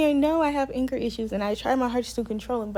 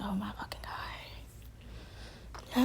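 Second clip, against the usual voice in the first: slow, barely audible whispered exasperation; exasperated whisper; 2 semitones lower, 13 dB quieter, much slower than usual. Retold or imitated. Resident reacts to being told no once again, exasperated whisper. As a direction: breathe it out slowly under your breath.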